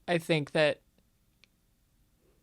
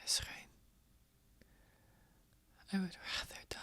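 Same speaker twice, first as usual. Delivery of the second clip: barely audible, halting refusal; faint, breath-only defiance; whispered defiance; 3 semitones higher, 12 dB quieter, faster than usual. Acted. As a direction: whisper your refusal to the captor. Captive refuses attacker in whisper.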